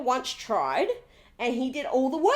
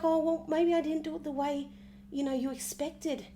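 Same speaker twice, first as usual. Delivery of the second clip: timid apology; 7 dB quieter than usual. Retold or imitated. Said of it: "She's upset".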